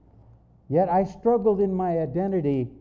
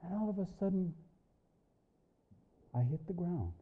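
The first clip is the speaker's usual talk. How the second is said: hushed aftermath; 9 dB quieter, faster than usual. Real, not acted. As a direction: come down to a hush with long pauses. Vision ends, he is alone.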